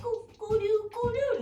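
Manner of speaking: put-on high, babyish cooing critter voice; cute little creature. Mocking what the cricket is not, cute high cooing. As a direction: coo like a harmless tiny bug. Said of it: "you know, like".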